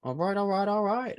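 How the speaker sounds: high, playful "all right" run. Pretend. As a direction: toss it off like a host. Host hyping up the final song.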